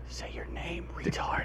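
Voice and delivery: hushed unvoiced aside; whispering. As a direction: whisper it like a secret. Whispered joke traffic sponsor.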